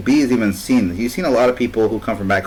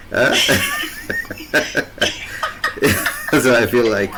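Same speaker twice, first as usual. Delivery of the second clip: laughing; 2 semitones higher, much slower than usual. Real, not acted. Laughing after the joke.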